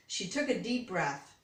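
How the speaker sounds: ordinary delivery, nothing marked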